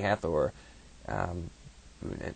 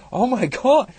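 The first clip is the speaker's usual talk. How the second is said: high, loud cry of shock; loud disbelief; 11 dB louder, slower than usual. Retold or imitated. Teller reacting to the myth with a loud, high gasp of shock. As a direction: blurt it out, floored.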